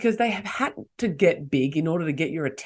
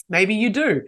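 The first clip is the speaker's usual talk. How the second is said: ordinary talk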